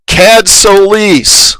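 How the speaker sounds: high, drawn-out call across a hangar. Acted. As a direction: call the name out slowly, pitched well up. Villain calls out across hangar.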